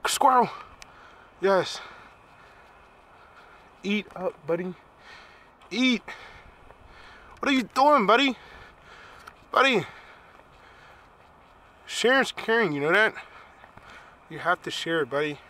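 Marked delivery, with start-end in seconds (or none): 0.1-9.8 high-pitched calls to an animal; excited calling out. Real, not acted. Vlogger cheering on a squirrel as it eats, excited. 11.8-13.1 high sing-out to an animal; playful chiding. Real, not acted. Vlogger telling a squirrel to share, playful and high.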